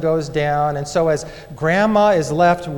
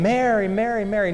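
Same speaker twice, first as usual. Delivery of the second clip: calling her name in a higher voice; 5 semitones higher than usual. Retold or imitated. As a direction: call out for a missing child, drawn into a chant. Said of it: "they start calling her name".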